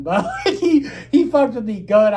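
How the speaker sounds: high, loud words breaking with laughter; laughing admission. Real, not acted. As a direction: admit it while cracking up. Still laughing about the prank.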